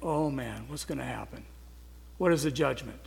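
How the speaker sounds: put-on like a worried kid outside the principal's office; hushed, fretful inner voice; anxious inner thought. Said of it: "your mind is racing like".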